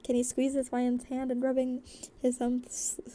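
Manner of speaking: usual speaking voice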